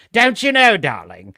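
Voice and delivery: affected upper-class English tease; posh English accent. Shown as a demonstration. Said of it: "posh English accent".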